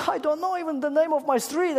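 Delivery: put-on high, loud lost-child voice; a little girl voice, lost and upset; crying little girl.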